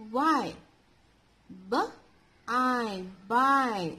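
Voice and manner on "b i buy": B and long "eye" apart, then joined as BUY; sounded out, then blended. Shown as a demonstration. Teacher shows children Y making long I, sounded out. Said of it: "We are pronouncing this as".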